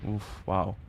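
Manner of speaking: ordinary delivery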